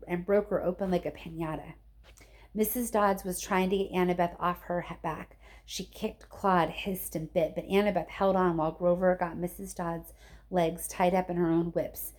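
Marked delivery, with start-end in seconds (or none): none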